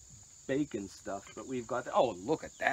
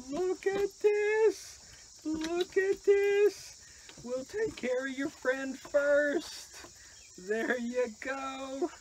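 very high, drawn-out pet talk; slow falsetto doggy talk; coaxing the dog; 14 semitones higher, slower than usual. Real, not acted.

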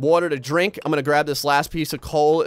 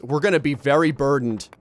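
ordinary talk

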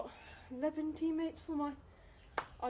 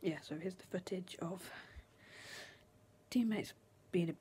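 whispering; 7 semitones lower than usual. Real, not acted. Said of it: "I have to whisper now".

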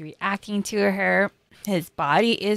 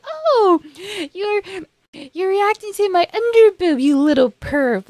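high, loud playful tease; mock-shocked girlish teasing; teasing Marin; 11 semitones higher, 6 dB louder than usual. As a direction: poke fun at him like Marin. Marin teasing him about underboob.

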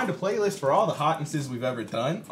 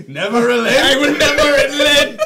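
mock battle cry; 13 semitones higher, 13 dB louder than usual. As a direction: declare it like a war hero. Mock defiant vow over the game.

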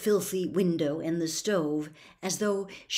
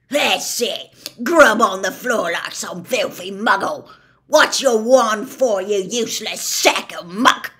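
loud, contemptuous tirade; furious berating; 3 semitones higher, 11 dB louder than usual. A narrator's reading. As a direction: spit the insults at her, full rage. Gaunt berates his daughter.

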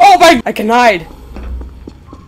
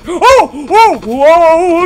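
high loud yelps of fright; startled cries; 9 semitones higher, 8 dB louder, much slower than usual. Real, not acted. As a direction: jump at the surprise.

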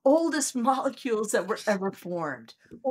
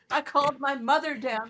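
ordinary talk

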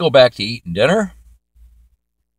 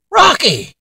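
sharp high call of the dog's name, louder; 13 semitones higher, 4 dB louder than usual. Acted. Dad at dinner calls out the dog under the table, high and loud.

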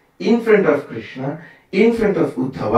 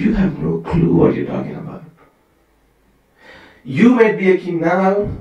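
raised, louder scolding of a king; higher, louder sage's rebuke; stern rebuke; 5 semitones higher, 3 dB louder than usual. Retold or imitated. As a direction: put the proud king in his place. Sage rebuking King Rahugana.

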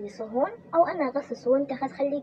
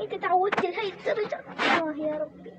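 tearful; 6 semitones higher than usual. Acted. Weeping over her hard life.

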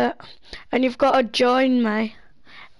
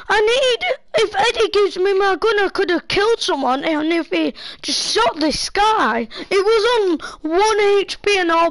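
excited; 8 semitones higher, 3 dB louder than usual. Real, not acted. Heated gaming rant to friend.

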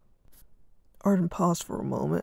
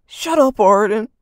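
loud sudden shout, pitched up; 4 semitones higher, 13 dB louder than usual. Acted. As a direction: explode at his brother. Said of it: "he shouted".

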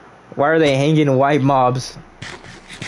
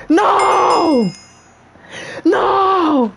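high, drawn-out cry of no; mock-horrified wail. Player seeing what Mikey did, mock-horrified wail. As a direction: wail it out in melodrama.

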